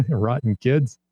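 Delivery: slow, lightly voiced old-man grumble. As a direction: mutter it like a grouchy grandpa. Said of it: "doing an old man accent".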